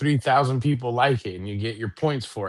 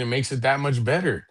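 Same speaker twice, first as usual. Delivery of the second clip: ordinary delivery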